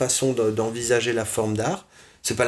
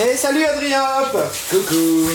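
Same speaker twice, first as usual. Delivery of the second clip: very high, cheerful hello; excited greeting; 5 dB louder than usual. Real, not acted. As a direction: call out a happy greeting. Greets a viewer joining live.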